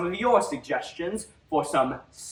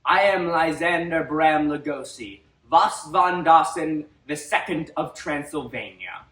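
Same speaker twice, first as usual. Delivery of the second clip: loud, booming vampire lord greeting; booming demon lord; 5 dB louder than usual. Acted. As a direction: boom it out like an ancient vampire count. Vampire host greets audience.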